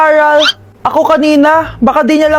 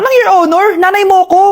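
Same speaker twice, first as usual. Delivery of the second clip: sharp, higher indignant scold; scolding mother; 5 semitones higher than usual. Acted. Mother scolding daughter who calls her Your Honor, indignant. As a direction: snap back at her kid for the joke.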